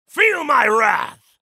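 wrathful god proclaiming; shouted threat. Acted. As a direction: roar it at the enemy. Attack shout.

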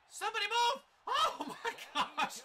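high, loud plea breaking into laughter; urgent yelling. Real, not acted. Gamer begging his players to move, urgent yell. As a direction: yell at teammates who won't move. Urges players on during a play.

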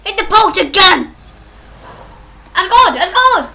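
loud, high victory yells; real jubilant shout; ecstatic shouting. Real, not acted. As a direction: yell it out after finally scoring. Scores a goal after failing.

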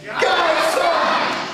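high, loud call. Acted. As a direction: call it out to the sky. Call response.

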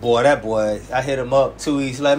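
usual speaking voice

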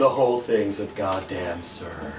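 very low, soft world-weary drone; bored ennui. Acted. Smoking friend agreeing with the punk, bored ennui. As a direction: sink low and sound above it all. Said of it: "exhaled a puff of stylish smoke and ennui".

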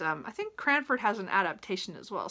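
normal talking voice

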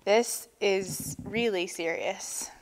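slow, soft groan of concern; groaned slowly and heavily. A narrator's reading. Walrus groans about how serious it is, groaning. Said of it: "groaned Walrus".

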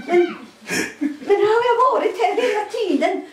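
high amazed rush; astonished question. Retold or imitated. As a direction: burst out in disbelief.

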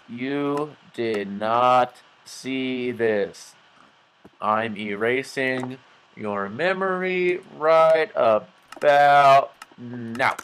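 very slow, drawn-out mind trick; playful hypnotic command, extremely slow; mock hypnotist. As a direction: stretch every word as if wiping the listener's memory. Mock memory erasing of viewers.

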